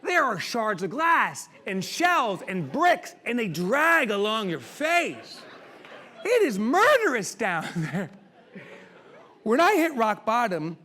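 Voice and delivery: slow, high, forceful preaching; impassioned loud. Real, not acted. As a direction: drag out every brutal word.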